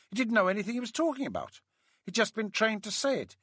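high-pitched, scornful woman's voice; complaining woman colleague. A narrator's reading. Colleague tells the narrator the training wasted time, high-pitched complaint. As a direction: rattle off a grievance in a high voice.

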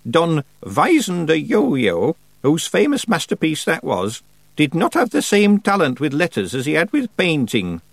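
put-on high, dry, precise old woman; stern old witch. A narrator's reading. Old witch lecturing Tiffany about the painter, dry and stern. Miss Treason's speech.

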